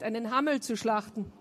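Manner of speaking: ordinary delivery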